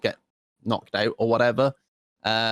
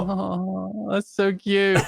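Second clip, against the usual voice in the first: high doting coo; sympathetic aww; 6 semitones higher, much slower than usual. Real, not acted. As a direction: melt over the puppy. Cooing over dog.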